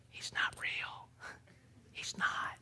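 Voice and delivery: secret whisper to the congregation. Pretend. Preacher whispering a secret to the church. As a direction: whisper it so the kids can't hear. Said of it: "Plug your ears. Listen".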